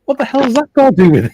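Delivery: fast, very high, loud outrage; retold raging. Neighbour arguing with pub staff over his accent, imitated raging. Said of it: "he was raging".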